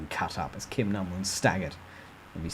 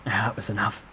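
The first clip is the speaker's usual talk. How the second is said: ordinary delivery, nothing marked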